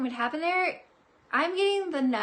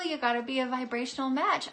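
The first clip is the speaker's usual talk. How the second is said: usual speaking voice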